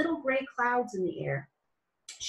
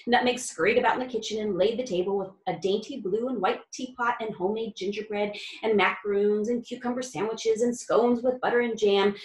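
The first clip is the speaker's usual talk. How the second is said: ordinary delivery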